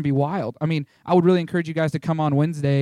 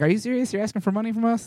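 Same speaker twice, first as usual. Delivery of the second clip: high-pitched, scoffing imitation; incredulous mock questioning, pitched up; mocking disbelief; 6 semitones higher, faster than usual. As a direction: mimic relatives scoffing at the request. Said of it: "laughing at me and saying".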